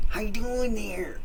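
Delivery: high, drawn-out mock holler; hollering neighbor imitation. Strange neighbor greeting passers-by, high slow mock holler. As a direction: stretch it out high, imitating his call. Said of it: "he would holler at us".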